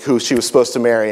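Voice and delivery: ordinary delivery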